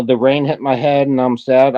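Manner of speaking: slow, low, mock sad whine; mocking whiny voice. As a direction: drag it out like a sulky complaint. Mocking Summer's complaint.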